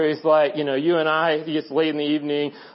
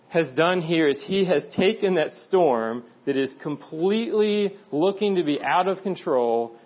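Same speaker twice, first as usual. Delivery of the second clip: ordinary delivery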